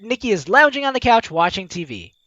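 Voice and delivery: ordinary talk, nothing marked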